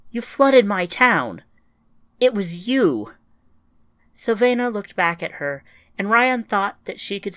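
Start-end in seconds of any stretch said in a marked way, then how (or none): 0.1-3.1 louder, slower accusation; accusing. Acted. Ryan confronting Savannah about the flood, louder and slow. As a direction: lay the blame on her.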